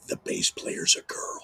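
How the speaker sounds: breathy hushed whisper; whispered secret. Retold or imitated. [name] leans in to tell the host, whispering. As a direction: lean in and whisper it like gossip. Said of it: "he leans in and goes".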